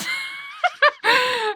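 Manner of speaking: loud, high, squealing laugh; shrieking laugh at a sad scene. Real, not acted. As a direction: laugh out in pained delight. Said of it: "I'm laughing with the pain".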